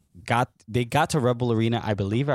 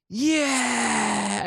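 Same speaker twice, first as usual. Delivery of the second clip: high hollered "Yeah!" with beer raised; drunk fan's cheer; 11 semitones higher than usual. Retold or imitated. Drunk fan cheering after faking an injury, high whooping yell. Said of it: "he just lifts his beer up and he goes".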